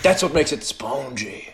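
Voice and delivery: slow mock French accent; terrible French accent. Co-host retrying Frenchie's line, slow bad French accent. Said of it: "That was a terrible French accent".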